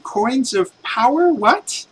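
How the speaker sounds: put-on a boy who has never heard of such things; lightly voiced puzzled kid. The boy questions the players about power coins, lightly voiced puzzled kid. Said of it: "he's like".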